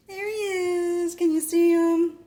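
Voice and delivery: high, slow pet-talk voice; showing off her cat in a sweet high coo. Real, not acted.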